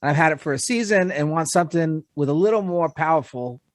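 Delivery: usual speaking voice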